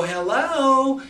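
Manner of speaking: high, loud drawled "Hello" with a long vowel. Shown as a demonstration. Coach shows a client's drawl, loud and high. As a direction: stretch "Hello" out like a slow drawler. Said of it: "If they got a little drawl in their voice".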